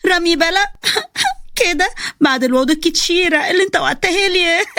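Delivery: put-on high, giggling hurt girlfriend; laughing. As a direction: laugh through the words in mock offence. Zeina mocks with laughter.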